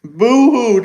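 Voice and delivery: taunting fake crying; sing-song fake boo-hoo; mock boo-hoo. Mocking crying critics.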